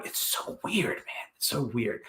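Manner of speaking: very soft, near-whispered aside; hushed disbelief. Real, not acted. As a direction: drop right down to a breathy near-whisper. Dwelling on a baffling story, hushed.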